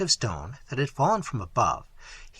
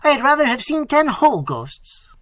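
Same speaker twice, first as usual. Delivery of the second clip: high, loud, frightened exclamation; agitated cry; 11 semitones higher, 8 dB louder than usual. A narrator's reading. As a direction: wail your dread to your master. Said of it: "cried Diego".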